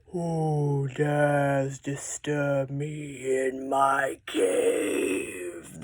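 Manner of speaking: low, slow dragon growl. Shown as a demonstration. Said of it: "make your voice sound exciting too".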